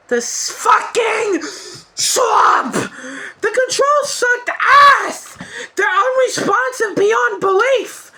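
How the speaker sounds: high-pitched, strained angry yelling; furious rant. Real, not acted. Reliving the awful swamp fight, furious and yelling. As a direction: rage at the broken controls. Raging about controls.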